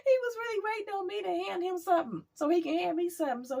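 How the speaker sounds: very high, laughing disbelief; real incredulous laughter. Real, not acted. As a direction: squeal it through helpless laughter. Cracking up over the powder offer.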